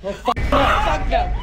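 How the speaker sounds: loud, slow, terrified refusal; panicked outburst. Real, not acted. Seeing her dog stare at the attic, terrified and loud. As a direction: recoil in real fright.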